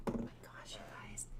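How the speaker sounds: hushed unvoiced whisper. Real, not acted. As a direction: breathe it out in a whisper. Animals fighting on camera.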